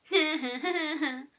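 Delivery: high, gleeful little chuckle; naughty boy's snigger; mischievous chuckle. A narrator's reading. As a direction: chuckle to yourself at someone's misery. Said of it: "chuckled Horrid Henry".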